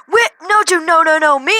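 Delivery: loud, high mock yell of overlapping protests; retold yelling. Teacher talks over the protesting kid, imitated yelling. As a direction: imitate yelling over someone, pitch jumping up. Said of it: "like, yelling".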